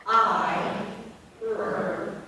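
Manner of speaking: I and ER loud, stretched and apart; isolated sounds. Shown as a demonstration. Teacher giving the next sounds, loud sounding out. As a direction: sound out a long I, then a separate er. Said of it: "next sound".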